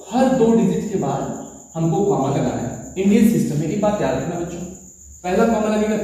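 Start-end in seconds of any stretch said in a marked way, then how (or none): none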